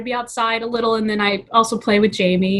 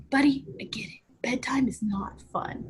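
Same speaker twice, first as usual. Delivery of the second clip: gentle soothing; 3 semitones higher, 8 dB quieter than usual. Retold or imitated. Mom soothing her son who fights bedtime, gentle whisper. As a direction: soothe a sleepy toddler.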